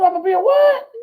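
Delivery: loud, high incredulous what; retold stunned outburst at being called an apostle; shocked disbelief. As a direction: blurt it out like you can't believe your ears. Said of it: "I said".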